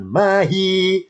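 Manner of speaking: "mahi" ending on an open vowel, no h; without final ha. Shown as a demonstration. Teacher showing the word without ha, open ending. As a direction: say mahi slowly, stopping on hi. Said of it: "kalau nggak pakai ha".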